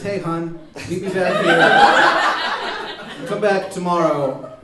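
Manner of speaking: high, loud, drawn-out note voice; note read aloud. Acted.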